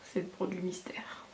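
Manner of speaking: playful hushed whisper; mystery whisper. Acted. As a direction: whisper it like a little secret. Teasing the mystery product.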